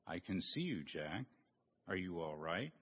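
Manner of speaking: low, soft divine voice calling down; voice of God. Acted. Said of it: "he heard a voice".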